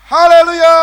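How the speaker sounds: haleluya shouted high and loud; joyful shout. Real, not acted. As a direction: cry haleluya out with the whole church. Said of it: "Coba tahan yang panjang". (teriakan haleluya yang tinggi dan keras)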